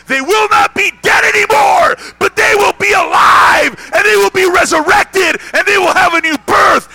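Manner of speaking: high, loud shouted proclamation; roaring, high-pitched sermon climax; full-force preaching. Real, not acted. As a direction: shout it out to the whole congregation.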